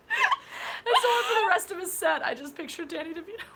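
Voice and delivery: high, squeaky laughing talk; helpless laughter. Real, not acted. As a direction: squeeze the words out through real laughter. Helpless laughter at own anecdote.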